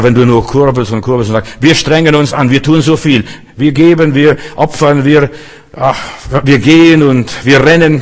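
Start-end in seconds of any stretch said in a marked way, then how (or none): 1.6-8.0 louder, higher imitation of strained effort; mock striving. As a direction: act out busy, straining believers, building in force. Spielt angestrengt strampelnde Christen nach. (gespielt angestrengt, immer lauter)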